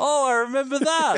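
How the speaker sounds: very high, acted squeal of recognition; nostalgic delight. Acts own nostalgic reaction.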